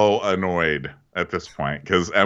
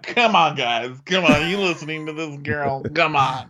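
exasperated pleading; 7 semitones higher than usual. Retold or imitated. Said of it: "Emily's looking around like".

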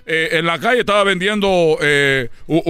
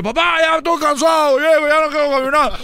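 put-on whiny tired kid; 8 semitones higher than usual. Tired son begs his father to stop walking, whining. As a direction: complain like a worn-out little boy.

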